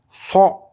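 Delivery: a heavy throaty "so"; emphatic S from the throat. Shown as a demonstration. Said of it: "à l'intérieur de la gorge".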